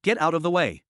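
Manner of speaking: lightly voiced stern parrot command; a hint of a bossy bird clearing the way; serious parrot shout. A narrator's reading. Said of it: "shouted in a serious tone".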